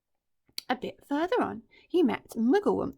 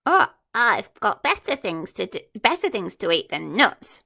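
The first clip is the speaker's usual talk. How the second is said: louder haughty sniff; sniffy disdain; 8 dB louder than usual. Acted. Crocodile sniffing at the monkey's nuts, haughty. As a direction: turn your nose up. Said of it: "sniffed the crocodile".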